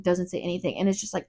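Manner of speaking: ordinary talk, nothing marked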